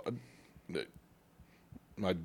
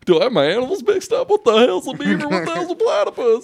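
confused high voice; 8 dB louder than usual. Retold or imitated. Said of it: "You're like".

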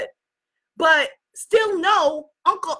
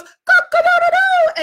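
rooster crow; 7 dB louder than usual. Retold or imitated. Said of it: "the rooster goes".

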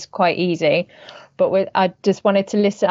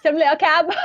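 high, wobbly attempt at a mixed accent; Cherry going Texan; 8 semitones higher, faster than usual. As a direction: attempt a Texan switch and lose it. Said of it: "Cherry accent into a Texan accent".

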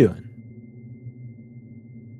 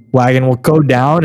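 ordinary talk, nothing marked

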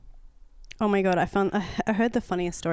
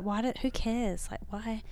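much softer, slow, trailing self-talk; muttered to herself; 8 dB quieter, slower than usual. Retold or imitated. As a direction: mumble it under your breath. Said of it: "I was like".

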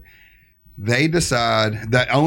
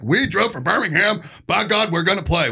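higher-pitched mock insistence; indignant band; 8 semitones higher than usual. Band that drove from Birmingham insisting on playing, mock indignant. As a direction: imitate stubborn musicians standing on principle. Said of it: "a lot of bands would be like".

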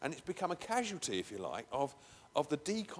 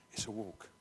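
"It's a walk." said low and soft; soft low repeat; quiet; 5 semitones lower, 8 dB quieter than usual. Shown as a demonstration. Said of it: "repeated as whisper".

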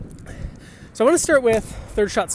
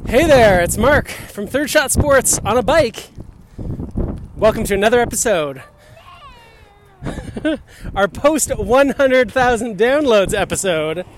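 podcast intro; 5 semitones higher, 4 dB louder than usual. Acted. Podcast intro read over music.

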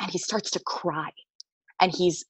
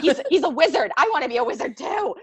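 excited gushing; 7 semitones higher than usual. Retold or imitated. Gushing over wizard.

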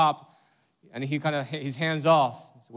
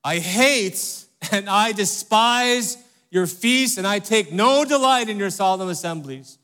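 God rebuking; 5 semitones higher, 5 dB louder than usual. Retold or imitated. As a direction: thunder it out as God scolding his people. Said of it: "he says".

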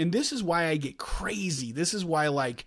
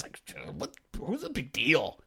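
mock dismissive wife; 3 semitones lower, 9 dB quieter than usual. Husband imitating his wife shrugging off the crash, soft mock whisper. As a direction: shrug it off under the breath. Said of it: "she's like".

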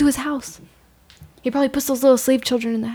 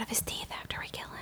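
whispered gleeful scheme; 20 dB quieter than usual. Acted. To the exiled companion, a whispered grisly promise. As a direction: hiss the grisly plan softly.

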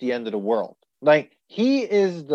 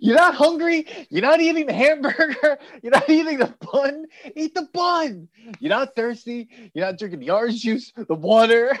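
higher-pitched mock rant; exasperated Tom Hanks; 7 semitones higher than usual. Owner arguing with barking dog, exasperated imitation. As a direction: list it off in comic exasperation. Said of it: "He's like".

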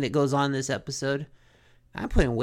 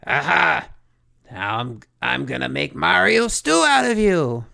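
a hint of a gloating Koopa, louder on the threats; lightly voiced menacing giant boss; mild villain voice; 5 dB louder than usual. King Koopa threatens the heroes.

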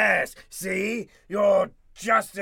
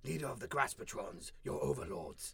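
hushed, low, breathy villain declaration; booming arrogant alien overlord; 10 semitones lower, 13 dB quieter than usual. Acted. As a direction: menace the puny humans.

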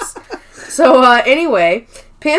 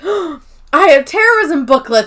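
giddy; 4 semitones higher, 5 dB louder than usual. Retold or imitated. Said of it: "he's so excited he has something".

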